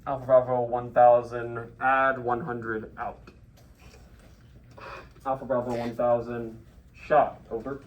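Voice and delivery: loud, measured radio readback. Shown as a demonstration. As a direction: read it back firmly over the net. Reads back the fire call.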